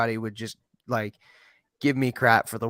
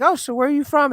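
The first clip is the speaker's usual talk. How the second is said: much higher, louder retold question; acted friendly question; 14 semitones higher, 5 dB louder than usual. Guest retelling asking a newcomer, high acted question. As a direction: pitch it way up as an acted inquiry.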